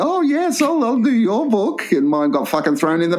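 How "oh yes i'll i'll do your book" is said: high, slow gushing agreement; sarcastic impression of a smitten publisher saying yes; mock eager yes. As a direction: fawn over the young girl's book. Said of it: "and you".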